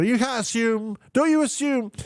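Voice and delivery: high, mocking scold. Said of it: "points out to the doctor".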